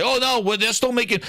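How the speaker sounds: sneering imitation of a company spokesman; mocking Microsoft. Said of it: "Microsoft will come out and say".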